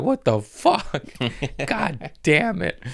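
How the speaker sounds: slow, very high comic exclamation; mock outrage. Imagining choking to death on a sandwich, mock outrage. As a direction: stretch it out high in hammed-up indignation.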